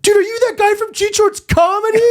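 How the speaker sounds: very high-pitched put-on fan squeal; mock fan excitement. Friend pretending to recognize him off the plane, mock excitement. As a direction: imitate a gushing fan making a scene.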